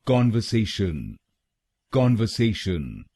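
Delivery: कॉन्वर्सेशन with an open "kon" at the start; correct pronunciation. Shown as a demonstration. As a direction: say कॉन्वर्सेशन as kon-ver-sey-shun, clearly and carefully. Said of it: "इसकी सही pronunciation है".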